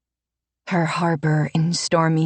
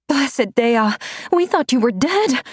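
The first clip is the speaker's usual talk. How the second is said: breathless; 8 semitones higher than usual. Acted. Renata reaching Alessa after the climb, breathless and higher. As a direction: gasp it out after running up the stairs.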